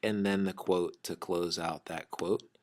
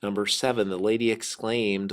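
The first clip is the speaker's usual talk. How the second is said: normal talking voice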